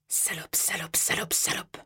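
terrified whispered pleading; murmured. A narrator's reading. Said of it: "murmurai-je".

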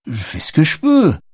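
high, put-on protesting voice; somewhat flustered self-defence; defensive. A narrator's reading. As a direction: protest under pressure. Driver defends himself under attack.

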